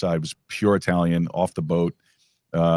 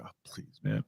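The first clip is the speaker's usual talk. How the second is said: whispered scoff; 13 dB quieter than usual. Retold or imitated. Retold dismissive reaction.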